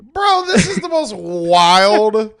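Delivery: amazed. Real, not acted. Cohost reacting to the wild movie plot, amazed and drawn out. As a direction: stretch it out, stunned by the story.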